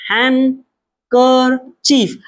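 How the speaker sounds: हैंकरचीफ very slow, split han, ker, chief; silent D, syllable by syllable. Shown as a demonstration. Tutor models the word for pupils, split into slow syllables. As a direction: break हैंकरचीफ into three spaced syllables without D. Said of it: "इसे डी नहीं पढ़ेंगे".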